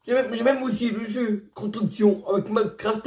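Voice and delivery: normal talking voice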